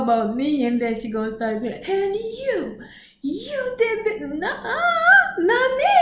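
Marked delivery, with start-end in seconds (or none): 1.9-4.2 much higher accusing voice; mock drunk accuser. 4.2-5.9 very high, laughing "nuh-uh"; laughing denial. Retold or imitated. Denying while laughing.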